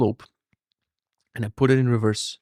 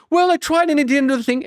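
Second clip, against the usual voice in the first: much higher, meek little voice; sheepish excuse; 13 semitones higher than usual. Retold or imitated. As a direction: shrink like a caught kid. Sheepish customer to grumpy repairman.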